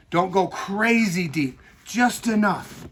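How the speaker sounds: slow, deliberate just enough; slow down to stress the point; careful emphasis. Shown as a demonstration. Teacher warning students, slow and deliberate. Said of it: "This is key right now".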